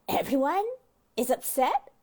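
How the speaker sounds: "Everyone is upset?" with a rising, doubting lift on "Everyone"; mock disbelief, rising at the end; incredulous question. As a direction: question the word "Everyone", pitch going up. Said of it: "I say to her".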